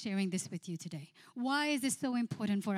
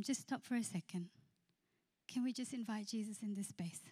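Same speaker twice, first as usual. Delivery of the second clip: hushed plea; 7 dB quieter than usual. Retold or imitated. Pastor hushing arguing couple.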